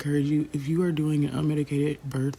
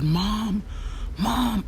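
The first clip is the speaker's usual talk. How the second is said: calling for mom; 8 semitones higher than usual. Retold or imitated. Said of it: "and I said".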